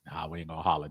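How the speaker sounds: low and very soft, setting up a yell; retold quiet refusal. Co-host imitates Low deciding not to holler, low and hushed. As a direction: understate it, keep it under the voice. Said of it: "then Low said".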